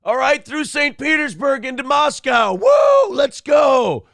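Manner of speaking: high, loud whooping rally call; hyped-up mutiny cry. Acted. Prigozhin whooping as he storms off toward Moscow. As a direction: fire up the troops like a frat boy.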